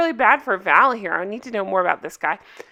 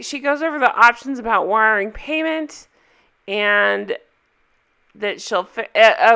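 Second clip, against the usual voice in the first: normal talking voice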